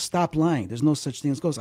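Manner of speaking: ordinary talk, nothing marked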